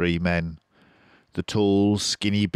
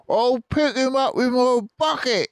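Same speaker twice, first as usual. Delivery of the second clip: much higher, gleeful farmer shout; Bunce shouting; 5 dB louder than usual. A narrator's reading. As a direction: brag over the engine roar. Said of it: "shouted Bunce".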